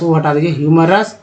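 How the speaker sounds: ordinary delivery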